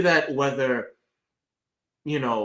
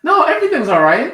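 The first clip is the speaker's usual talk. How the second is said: high, bright laughing cheer; jubilant fake cheer; 11 dB louder, faster than usual. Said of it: "that jubilant smile".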